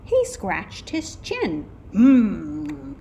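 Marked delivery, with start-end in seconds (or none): 1.9-2.9 loud put-on thinking hum; pondering detective. A narrator's reading. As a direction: hum like a detective mulling it over.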